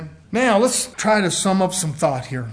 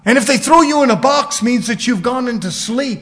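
loud, higher burst easing off; emphatic preacher's declaration; full-force preaching; 5 semitones higher, 10 dB louder than usual. Real, not acted. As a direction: punch it out from the pulpit.

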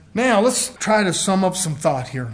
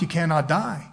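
usual speaking voice

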